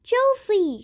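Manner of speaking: high, loud call of the name; urgent, panicked yell; alarmed shout. A narrator's reading. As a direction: shout to stop her. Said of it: "shouted Grandpa Joe".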